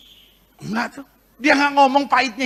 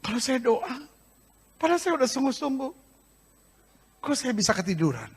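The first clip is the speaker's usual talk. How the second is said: much softer, lower complaining plea; whiny quiet complaint; 3 semitones lower, 10 dB quieter than usual. Retold or imitated. Churchgoer telling the pastor he fell asleep, softly whining. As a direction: mumble it like a tired excuse. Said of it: "ada orang bilang sama saya".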